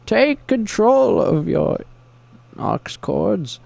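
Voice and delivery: high, slow villain proclamation; Bane impression. Villain urging the town to take back its music, grandiose. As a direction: declaim it as a high-pitched mock supervillain.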